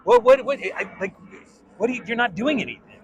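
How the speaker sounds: high loud sputtering disbelief, fast; exasperated director. Retold or imitated. Director baffled by the flat audition, sputtering in mock exasperation. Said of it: "He was like".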